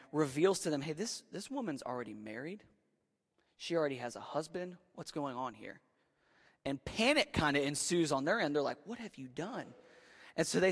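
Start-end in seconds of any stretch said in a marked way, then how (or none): 0.8-5.8 hushed divine warning. Retold or imitated. God revealing to Egyptians. 8.8-9.7 hushed mock panic. Egyptians panic.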